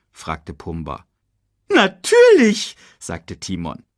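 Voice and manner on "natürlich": high, loud, stretched agreement; emphatic meerkat. Acted. Meerkat agreeing to keep the lion, high drawn-out exclamation.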